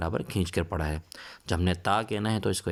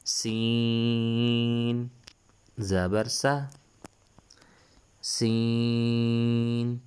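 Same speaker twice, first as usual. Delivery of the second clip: Qaida recitation drill; 4 semitones higher, much slower than usual. Shown as a demonstration. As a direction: stretch every letter name out for the class. Letter drill chant.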